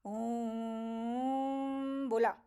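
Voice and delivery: very slow, drawn-out om; long drawn om. Shown as a demonstration. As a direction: hold the om long and steady. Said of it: "अगर हमने".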